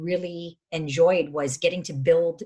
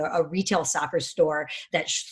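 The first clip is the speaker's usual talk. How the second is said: normal talking voice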